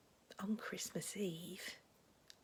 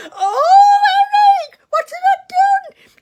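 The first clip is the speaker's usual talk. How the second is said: very high, loud hammed-up wail; loud theatrical sobbing lament; mock wailing; 28 dB louder, slower than usual. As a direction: cry out in comic anguish. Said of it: "she was wailing".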